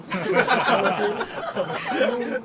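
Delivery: short playful giggle. Real, not acted. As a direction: laugh at her own silly plea. Laughs after talking to grass. (हल्की सी हँसी)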